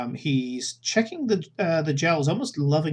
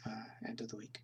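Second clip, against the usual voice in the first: whisper; 4 semitones lower, 21 dB quieter, faster than usual. Real, not acted. GM whispering an aside.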